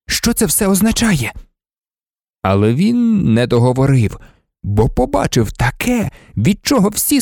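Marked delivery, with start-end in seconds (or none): 0.1-1.3 higher, impatient question; can't hold it in; impatient outburst. A narrator's reading. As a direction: burst out with the question. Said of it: "враз не витерпів Гаррі".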